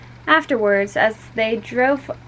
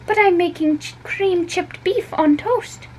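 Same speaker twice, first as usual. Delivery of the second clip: mother's voice; 11 semitones higher than usual. A narrator's reading. Mother proudly names the meal.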